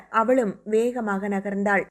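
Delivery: usual speaking voice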